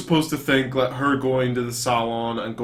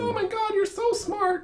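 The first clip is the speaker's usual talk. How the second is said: put-on much higher, airy fawning girl; bimbo voice; 4 dB quieter, slower than usual. Friend mocks the bimbo fawning over the dad, in a high put-on voice. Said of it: "put forward as a bimbo".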